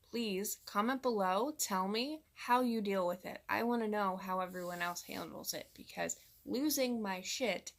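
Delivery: ordinary delivery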